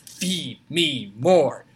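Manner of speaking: very slow, drawn-out wrestler chant; Ryback impression. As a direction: stretch each word out like a big monster. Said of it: "call down and just go".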